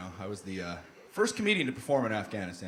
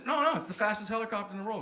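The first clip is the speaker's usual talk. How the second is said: higher-pitched, emphatic correction; insistent; 8 semitones higher, faster than usual. Retold or imitated. Pilot corrects a doubting passenger, insistent.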